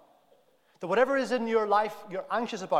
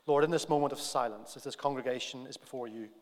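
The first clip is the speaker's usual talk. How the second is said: leading prayer; 6 semitones lower, 4 dB quieter than usual. Acted. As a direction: pray it quietly and reverently for the congregation. Leads congregation in prayer.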